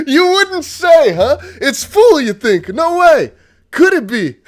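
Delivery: loud, high, slow sarcasm through laughter; mock the obvious while laughing; sarcastic. Real, not acted. Mocking Nikki's obvious message.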